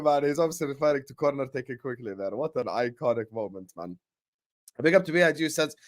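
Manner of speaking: usual speaking voice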